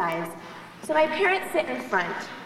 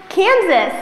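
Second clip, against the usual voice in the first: louder, higher "Kansas" with a falling end; mock shock; 5 semitones higher, 10 dB louder than usual. Snobby roommate hearing her roommate is from Kansas, mock shock. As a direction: blurt the state as if appalled. Exclaims at roommate's home state.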